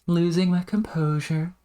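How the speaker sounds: high, held singsong; mock composure loss. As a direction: say it in a high playful voice. Mock line about own frustration.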